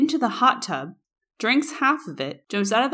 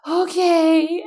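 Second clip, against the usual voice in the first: slow, sorry, a little higher; drunk remorseful guy; 9 semitones higher, 7 dB louder than usual. Retold or imitated. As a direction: drag out the apology like a guilty kid.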